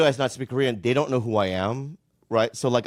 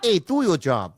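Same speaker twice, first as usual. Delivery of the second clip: put-on failed Boston accent; 6 semitones higher, slower than usual.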